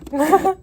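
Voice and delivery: short high laugh breaking out; laughing at the customer's rude joke; burst of laughter. Real, not acted. Laughing as she retells the customer's joke.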